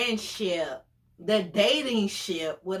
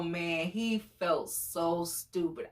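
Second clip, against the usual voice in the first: usual speaking voice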